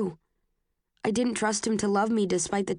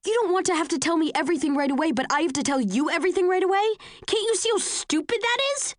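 angry rant; 9 semitones higher, faster than usual. Acted. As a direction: fire it back at him quickly. Tris angrily argues with Tobias.

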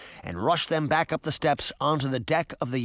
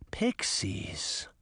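whispered; 7 dB quieter than usual. A narrator's reading. Narrator's sudden realisation.

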